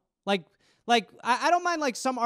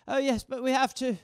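mild fretful mother; 3 semitones higher than usual. Retold or imitated. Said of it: "She's just literally on the couch going".

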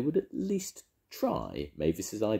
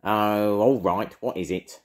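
grumpy witch; 6 semitones higher, 8 dB louder than usual. Acted. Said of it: "snorted Edna".

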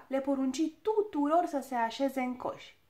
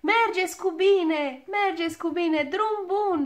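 higher, louder, slow farewell shout; shouting goodbye; 7 semitones higher, 7 dB louder than usual. A narrator's reading. Dwarfs shouting farewell to the rising balloon, loud. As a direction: wave them off with a big call. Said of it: "strigară piticii".

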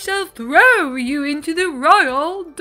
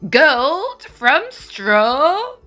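slow, louder, greedy disbelief; a greedy king hearing of gold; greedy cry; 4 semitones higher, 8 dB louder, much slower than usual. A narrator's reading. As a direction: savour the word gold, hungry for it. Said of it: "the king cried greedily".

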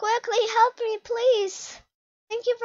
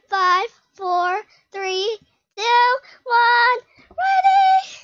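excited countdown; 3 semitones higher, 7 dB louder, much slower than usual. Real, not acted. Gamer finishing the countdown to start, excited call.

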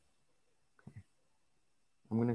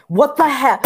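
very high, very loud shriek of rage; explosive high-pitched gamer outburst; real furious scream; 21 dB louder than usual. Real, not acted. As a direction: scream it in outraged disbelief. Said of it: "I'm so mad".

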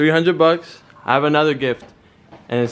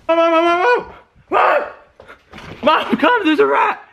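fake rat panic. Son pranks his mom with a fake rat, mock panicked screaming. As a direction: scream as if a rat just ran past. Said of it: "I'm gonna pretend that there's a rat in here".

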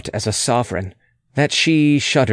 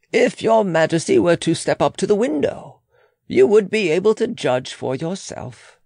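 higher-pitched, deferential official's voice; advising official; 7 semitones higher than usual. Acted. Official inviting the Queen to the window, higher and formal. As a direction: advise politely in a raised pitch.

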